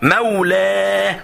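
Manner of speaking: "مولى" very drawn out on the final open a; slow demonstration of "مولى" with open ending; open fatha ending. Shown as a demonstration. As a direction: stretch "مولى" into a long mawlaaa. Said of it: "الفتحة دي بكلية".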